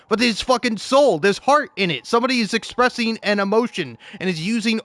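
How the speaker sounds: passionate outburst. Real, not acted. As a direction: insist there's heart in it. Passionate rant about art.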